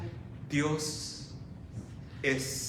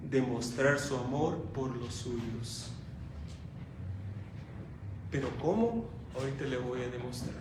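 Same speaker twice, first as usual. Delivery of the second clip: ordinary talk, nothing marked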